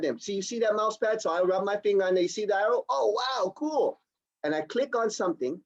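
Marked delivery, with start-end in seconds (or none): 2.9-4.0 acted amazement. Acts out user seeing the arrow.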